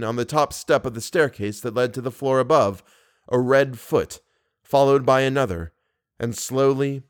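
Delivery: plain reading voice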